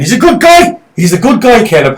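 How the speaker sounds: loud, high-pitched insistence; emphatic defending. Real, not acted. Hotly defending a film character.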